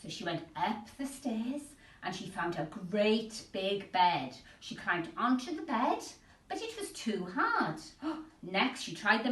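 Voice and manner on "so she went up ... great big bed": plain reading voice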